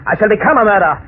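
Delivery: rising fury. Acted. Revived killer telling his rescuers of revenge, rising rage. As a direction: let the madness build.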